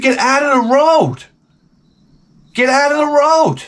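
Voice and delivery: high-pitched yell across the road; retold yelling. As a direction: re-enact yelling an urgent order. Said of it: "I started yelling at her".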